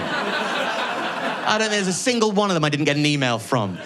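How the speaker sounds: excited rant. Real, not acted. To the audience about right-wing offers, excitedly ranting. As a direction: boast about the offers with rising glee.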